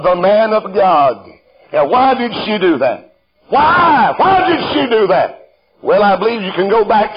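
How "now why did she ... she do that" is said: rhetorical preaching question. Acted. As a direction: hammer the question to the congregation.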